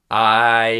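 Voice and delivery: loud held I vowel; twanged I. Shown as a demonstration. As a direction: sing the I with twang. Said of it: "Um, I".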